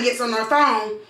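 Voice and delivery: usual speaking voice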